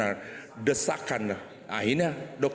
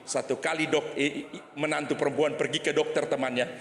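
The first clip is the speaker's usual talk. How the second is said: ordinary talk, nothing marked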